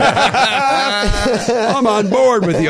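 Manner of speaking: high, slow gushing agreement; mock Palin agreeing. Playing Palin agreeing to a bigot, slow and high. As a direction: purr agreement like a politician. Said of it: "these gays in the military".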